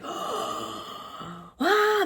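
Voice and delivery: high, excited little ah; delighted gasp. Retold or imitated. Fan finding old Linkin Park mail, high delighted ah. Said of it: "I saw this today and I was like".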